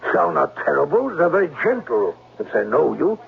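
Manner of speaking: put-on German accent. German-accented Klemper lures the governess to the gazebo. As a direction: charm her in a heavy German accent.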